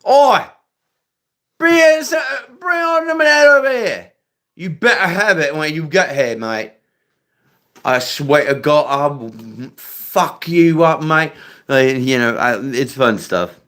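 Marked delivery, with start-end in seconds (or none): 0.1-4.1 very high, loud Cockney shout; hammed-up Cockney yell, T's dropped; Cockney accent. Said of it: "It's like Cockney people". 4.6-11.3 raised, threatening Cockney; mock-menacing Cockney accent. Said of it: "It's like Cockney people".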